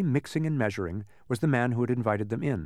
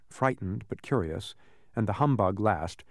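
usual speaking voice

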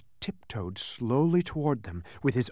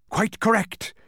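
high, loud gloating cry; triumphant shriek; 11 semitones higher, 6 dB louder than usual. A narrator's reading. The man confirming Milo's guess, triumphant shriek. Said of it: "he shrieked triumphantly".

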